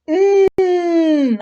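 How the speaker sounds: sustained M hum; sounding out M. Shown as a demonstration. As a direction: hold the mm for a child to copy. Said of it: "a ver, di, mm".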